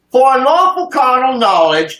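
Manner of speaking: high-pitched, mock-polite announcement; mock delicate. Shown as a demonstration. Giving critics the delicate version, mock-delicate and high. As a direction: pitch it way up, primly over-delicate. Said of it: "a little bit more delicate for you".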